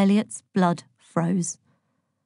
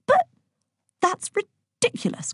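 indignant cry. A narrator's reading. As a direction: protest in disbelief. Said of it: "cried Virgo".

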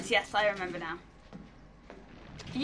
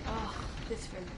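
ordinary delivery

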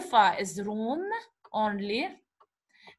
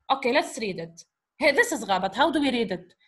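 ordinary talk

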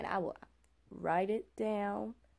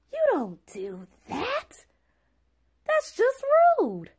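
slow and loud, climbing to a high indignant cry; frustrated outburst; 14 semitones higher, 9 dB louder, slower than usual. Real, not acted. Telling viewers about the Uber driver hanging up, exasperated loud cry. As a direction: let the annoyance burst out. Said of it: "And I'm getting frustrated".

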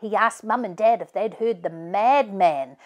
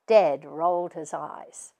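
ordinary delivery, nothing marked

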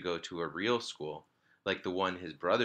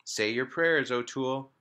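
snarl; 5 semitones higher, 5 dB louder than usual. A narrator's reading. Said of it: "she snarled".

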